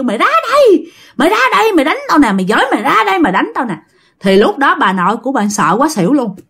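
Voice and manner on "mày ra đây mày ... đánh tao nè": high-pitched taunt, easing down towards the end; acted calling-out. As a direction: imitate a ghost calling her out, insistent. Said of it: "bà vừa kêu".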